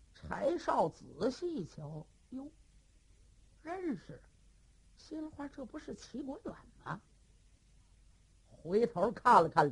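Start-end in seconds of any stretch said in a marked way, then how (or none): none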